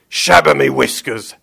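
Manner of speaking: put-on loud grumpy old villain; Mister Gum grumbling. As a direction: curse under your beard. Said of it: "he heard Mister Gum mutter".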